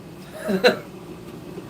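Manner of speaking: short high chuckle; real laugh. Real, not acted. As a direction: let out a brief, breathy laugh. Laughs after the impression.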